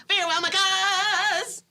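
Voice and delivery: high, slow, overwrought farewell; milk the tragic farewell; melodramatic. Acted. Melodramatic Romeo exit line.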